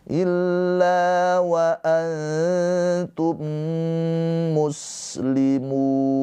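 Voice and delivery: high slow chant, antum muslimun joined by a long mm; merged held mim. Shown as a demonstration. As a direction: melt antum into muslimun on a humming m. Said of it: "ditahan dua harokat". (antum muslimun dilebur dengan dengung mim ditahan)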